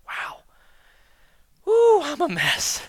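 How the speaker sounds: drawn-out high "Ooh" of dismay; slow, high shocked groan at yourself; dismayed realization. Retold or imitated. A believer realizing before God, slow high dismayed groan.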